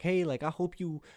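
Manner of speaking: guy reading out his awkward texts to friends, high and sing-song; awkward friendly texts. Pretend. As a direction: gush at a friend you barely know how to text. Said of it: "I feel awkward when I text them".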